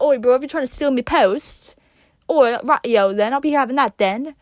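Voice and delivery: hammed-up London geezer accent; a lightly put-on cockney British accent; mock British accent. Imagining the movie British, a mock British accent, loud. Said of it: "so much better if it was British".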